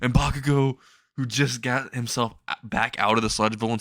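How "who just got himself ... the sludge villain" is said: plain reading voice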